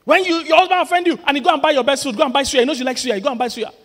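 high, loud, animated telling; excited preaching. Real, not acted. Preacher telling wives about buying suya, high and excited. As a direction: paint the scene with energy. Preacher acting out wife's peace offering.

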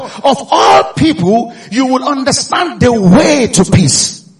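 "of all people" said slowly and loud, each word leaned on; stress on of all people. Shown as a demonstration. Preacher stressing "of all people" to the congregation. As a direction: draw out "of all people" for the crowd. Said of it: "you can notice that word".

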